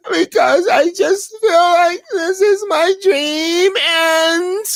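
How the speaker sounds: high wailing fake sob. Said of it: "They start crying".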